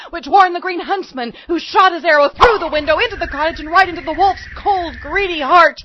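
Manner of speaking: high, loud climactic storytelling; excited narration. A narrator's reading. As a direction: drive the action to its climax.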